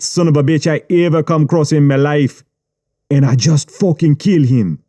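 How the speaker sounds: put-on Jamaican accent, cold menace; Jamaican gangster. Gangster threatening writer.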